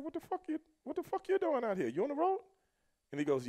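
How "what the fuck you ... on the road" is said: high-pitched retold disbelief; incredulous questioning. Wrestler confronting the new guy on the road, high-pitched disbelief. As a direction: act out baffled questioning, pitched way up.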